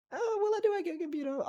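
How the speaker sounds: squeaky falsetto; high silly voice. Acted. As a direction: pitch it way up, goofy. Sudden silly voice.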